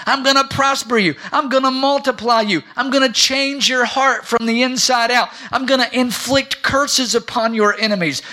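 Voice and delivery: high, loud, building list of promises; full-throated sermon delivery; fervent preaching. Real, not acted. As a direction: proclaim each promise with rising force. Preaches God's promises at full force.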